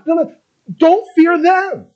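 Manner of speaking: usual speaking voice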